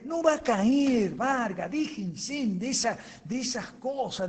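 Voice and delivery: emphatic denial. Real, not acted. Rebutting caller's hopeful claim.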